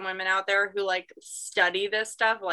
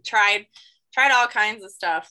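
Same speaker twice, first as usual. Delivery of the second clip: ordinary delivery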